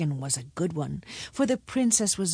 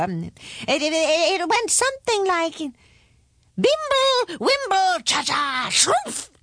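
high, hesitant, wavering wizard voice; dotty old wizard character; forgetful, flustered wizard; 8 semitones higher than usual. A narrator's reading. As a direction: fumble for the memory, then chant the spell higher.

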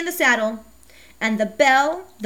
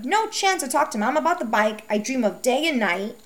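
plain reading voice

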